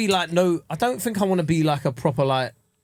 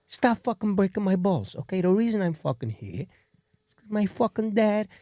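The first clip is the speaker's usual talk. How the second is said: a lightly voiced Italian mob boss, irritated; mild mob boss rant; 5 semitones higher than usual. A lightly voiced mob boss telling his crew to quit it. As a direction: grumble like a fed-up mobster telling him off. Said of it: "I wanna be in an Italian mob film".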